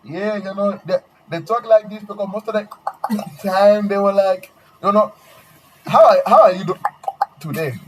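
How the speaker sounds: put-on high, slowed made-up accent voice; fake African accent. Fooling classmates with a fake accent, high and exaggerated. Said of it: "I literally go".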